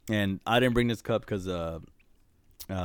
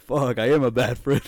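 loud, raised-pitch retold dismay; mock self-reproach; 4 semitones higher, 9 dB louder than usual. Retelling Ernie owning up to forgetting, loud mock self-reproach. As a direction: blurt it out as if just realizing. Retold friend realizing he forgot birthday.

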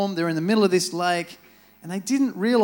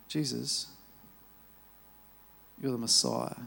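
hushed reverence; 6 semitones lower, 6 dB quieter than usual. Retold or imitated. Peter quietly confesses to Jesus who he is, hushed and reverent.

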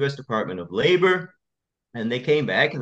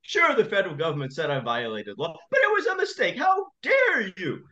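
high mock judge rising to a yell; shouting judge; 6 semitones higher than usual. Judge yelling at the lawyer for going over his head, high shout. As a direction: huff and then explode as the offended judge. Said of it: "he was shouting at me".

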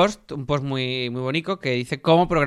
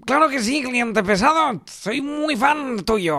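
high, very slow cartoon voice; slow, high goofy character voice; Bendert; 8 semitones higher, much slower than usual. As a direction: drag it out high like a silly cartoon sidekick. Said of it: "¿verdad, Bendert?".

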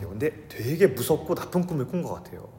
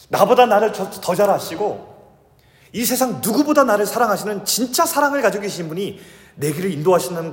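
loud, high, urgent preaching to the congregation; full-force preaching; 7 semitones higher, 6 dB louder than usual. Real, not acted. Pastor urging the congregation at full force. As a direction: pour it out like a pulpit appeal. Passionate sermon on the Holy Spirit.

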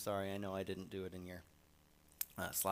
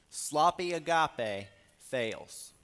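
very slow and loud, hitting sloppy; scornful emphasis; 5 semitones higher, 9 dB louder, much slower than usual. Acted. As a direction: sneer at the sloppy love. Contrasting false love.

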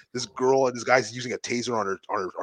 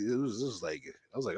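ordinary delivery

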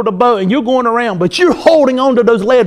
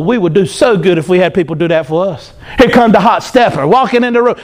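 ordinary talk

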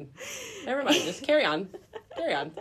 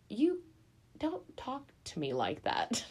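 a hint of a stern old woman; 12 dB quieter than usual. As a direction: put him in his place, quietly. Kaede scolding in retold quote.

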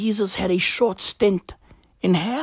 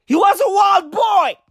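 high, loud bellow; hyped shout; 10 semitones higher, 4 dB louder than usual. Acted. Comic wrapping up the bit with a loud, high bellow. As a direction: roar it like a hype man.

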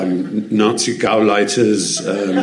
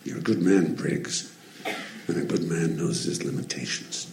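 Clint quote; 4 semitones lower, 10 dB quieter than usual. Retold or imitated. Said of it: "Clint says".

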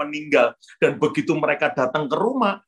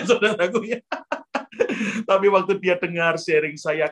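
ordinary talk

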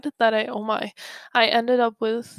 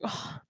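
disgusted ugh; 12 dB quieter than usual. Retold or imitated. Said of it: "I was like".

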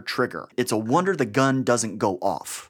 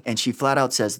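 normal talking voice

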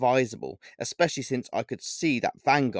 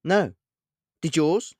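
annoyed; 7 semitones higher, 5 dB louder, slower than usual. A narrator's reading. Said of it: "replied Vanguard immediately, and very annoyed".

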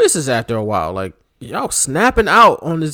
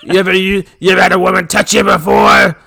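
loud mock-gravelly heckling; taunting wrestler promo; 5 semitones higher, 5 dB louder than usual. Podcaster pretending to be the wrestler taunting his rival, loud growling mockery. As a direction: bellow it like a hammed-up wrestling villain.

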